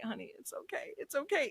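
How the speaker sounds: whispered, soft comfort; gentle whisper. Retold or imitated. Husband comforting his crying wife in a hushed whisper. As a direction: breathe it out gently to her. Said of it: "he was just like".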